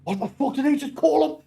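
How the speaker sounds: high incredulous mock foreman; put-on high voice of disbelief; imitating the foreman. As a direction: squeak out the shocked foreman. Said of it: "the foreman just went like".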